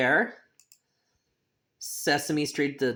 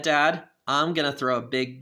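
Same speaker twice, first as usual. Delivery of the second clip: ordinary delivery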